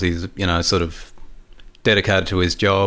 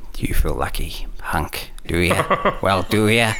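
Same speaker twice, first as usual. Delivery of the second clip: Michael Douglas impression; slower than usual. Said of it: "Harry lines in Douglas's voice".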